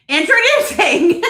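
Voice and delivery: a loud, high reveal breaking into a laugh; dramatic announcement. Real, not acted. Host laughing while unveiling her book title to viewers. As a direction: announce it big and crack up. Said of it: "I gotta give it a little dramatic flair here".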